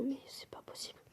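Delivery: stunned whisper of disbelief. Real, not acted. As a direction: whisper it in awe. Disbelief at the generous gift.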